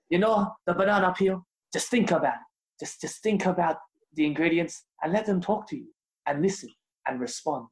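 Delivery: put-on dreamy chef in an Italian accent; Massimo Bottura Italian accent. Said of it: "I can put on a fake Italian accent".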